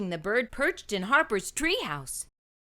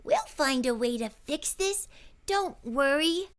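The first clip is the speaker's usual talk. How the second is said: reassuring; 5 semitones higher than usual. A narrator's reading. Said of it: "she said".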